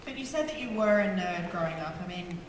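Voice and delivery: ordinary talk, nothing marked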